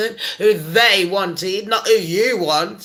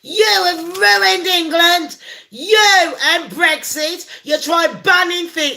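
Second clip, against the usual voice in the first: mock furious shout, much higher; angry landlord; 8 semitones higher, slower than usual. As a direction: yell it at the politician, slowing right down on the middle lines. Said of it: "he's gone".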